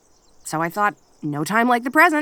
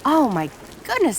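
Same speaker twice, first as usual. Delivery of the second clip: startled; 6 semitones higher than usual. Real, not acted. Kitsune startled by a thunderclap, sharp high cry. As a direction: jolt at the thunderclap.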